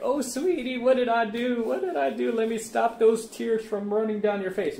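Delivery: high, sugary mock pleading; mocking simp voice. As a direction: ham up a groveling boyfriend. Mocking a soft simp response.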